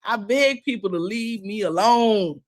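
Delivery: normal talking voice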